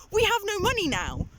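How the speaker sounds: normal talking voice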